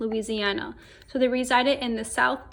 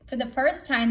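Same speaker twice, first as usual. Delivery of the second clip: plain reading voice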